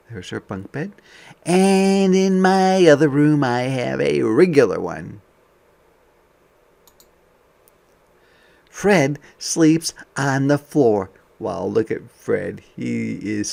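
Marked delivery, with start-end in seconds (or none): 1.4-11.1 bright, lively picture-book voice; reading to children. Acted. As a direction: read it out to a class of kids.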